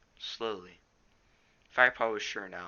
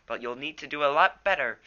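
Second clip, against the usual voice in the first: louder, higher spat taunt; spat challenge; 6 semitones higher, 9 dB louder than usual. A narrator's reading. Yellowfang taunting Firepaw after being thrown, spitting defiance. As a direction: snap back the challenge. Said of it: "she spat back".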